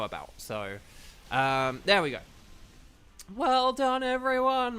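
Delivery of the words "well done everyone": voice cracking up an octave. Real, not acted. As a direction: voice cracks high on a loud cheer. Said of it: "My voice cracked there".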